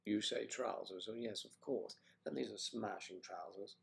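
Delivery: British accent. Shown as a demonstration. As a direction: play the English gent approving trousers. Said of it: "I'm challenged now to replicate Claire's accent".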